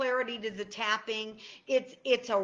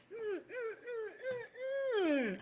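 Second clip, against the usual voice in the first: blissful hums; 12 semitones higher, 7 dB quieter, much slower than usual. Real, not acted. Savoring how good it feels.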